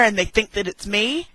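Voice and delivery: ordinary talk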